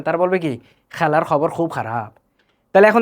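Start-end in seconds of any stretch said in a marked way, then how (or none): 0.9-2.1 Sylheti rasped fricative kh; Sylheti accent. Retold or imitated. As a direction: scrape every kh the Sylheti way. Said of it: "সিলেটিদেরকে বলবেন". (সিলেটি উচ্চারণে ঘষা খ)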